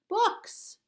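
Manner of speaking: very high, loud bright answer; eager girl. Acted. Girl answers the guard, high and bright. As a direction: chirp it innocently.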